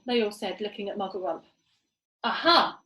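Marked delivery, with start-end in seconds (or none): 2.2-2.8 loud gleeful aha; triumphant cry. Acted. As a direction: crow with delight. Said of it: "cried Muggle Rump".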